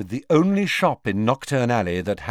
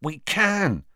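high, keen realisation; light up with a sudden idea; eager, high-pitched reply; excited; 7 semitones higher than usual. A narrator's reading. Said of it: "said Ron excitedly".

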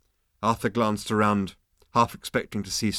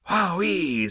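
high delighted wowee; excited exclaim; 8 semitones higher than usual. Acted. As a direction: gasp it out in awe. Zaphod exclaims in wonder.

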